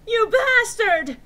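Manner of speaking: slow high furious shout; overacted angry yell; hammy stage actor. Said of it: "I shouted angrily".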